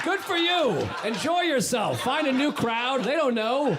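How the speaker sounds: high, slow, big-hearted cheer; cheering her on. Retold or imitated. Cheering on the cake lady from the next car. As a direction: shout encouragement across the train car.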